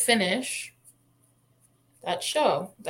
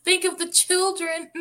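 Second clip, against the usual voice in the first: hammed-up worried crowd, louder and higher; mock alarm; 7 semitones higher, 6 dB louder than usual. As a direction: wail it like a panicked onlooker in mock horror. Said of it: "people are like".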